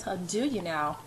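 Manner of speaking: a dry, sardonic mutter. A narrator's reading. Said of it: "I mutter, my voice sardonic".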